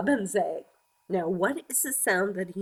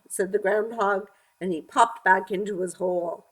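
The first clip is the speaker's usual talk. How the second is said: ordinary delivery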